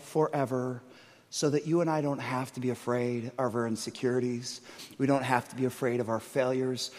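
low, soft, slow assurance; tender reassurance. Acted. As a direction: comfort the frightened congregation. Tender preaching climax.

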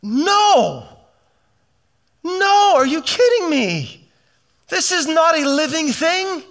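high, forceful rebuke; Jesus refusing a fake temple; Jesus indignant. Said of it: "Jesus was just like".

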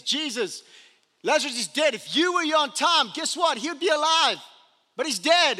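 high, loud and pointed; grieving sister. Acted. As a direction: rub it in: guess what, he'd be alive.

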